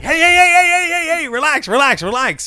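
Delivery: high, rapid placating hey-hey; calm him down breezily; placating doctor. Acted. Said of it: "He's like".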